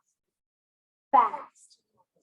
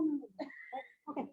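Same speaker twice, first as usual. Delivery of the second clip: ordinary talk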